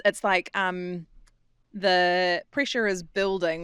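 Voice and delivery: ordinary delivery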